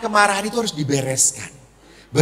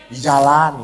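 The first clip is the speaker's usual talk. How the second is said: low, brief reply; a hint of a cornered husband, pitched down; lightly voiced husband; 6 semitones lower than usual. Husband answering his suspicious wife, lightly acted low reply.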